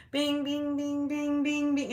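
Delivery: high, steady ringing bings; imitated beeping. Storyteller mimicking the tag beeping, high imitated chime.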